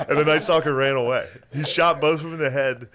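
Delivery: ordinary delivery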